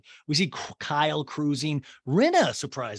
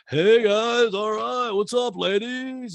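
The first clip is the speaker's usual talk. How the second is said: higher, drawn-out showy greeting; Mauricio; 7 semitones higher, 6 dB louder, much slower than usual. Retold or imitated. Podcaster mimicking Mauricio greeting ladies, showy mock hail. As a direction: make a hammed-up grand entrance.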